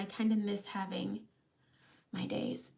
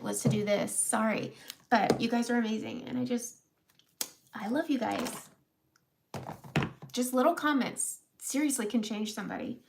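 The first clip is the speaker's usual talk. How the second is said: ordinary talk, nothing marked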